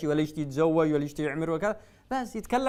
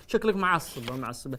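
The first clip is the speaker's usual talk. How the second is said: normal talking voice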